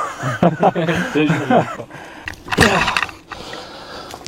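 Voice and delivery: long burst of laughter; hearty laugh. Real, not acted. Laughing at his own joke.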